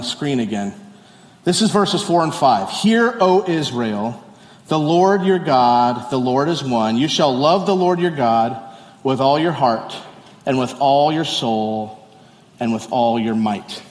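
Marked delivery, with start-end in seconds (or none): 2.7-13.8 slow, deliberate, solemn reading with long pauses; slow scripture reading. Acted. Pastor rereads the key verses for the church, slow and solemn. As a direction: let each phrase land slowly.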